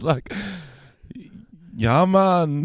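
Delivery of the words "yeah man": high, drawn-out mock Jamaican accent. Classmate answering in improv scene, imitated Jamaican accent. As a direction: put on a lilting Jamaican accent. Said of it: "why are you speaking in a Jamaican accent".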